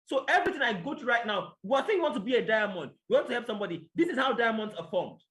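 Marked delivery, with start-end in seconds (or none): none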